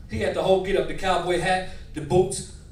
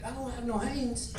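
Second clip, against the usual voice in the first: soft, slow, small voice; meek reply; 5 semitones higher, 12 dB quieter, slower than usual. Retold or imitated. Grandson answering a scolding grandfather, soft meek protest. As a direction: protest quietly and slowly.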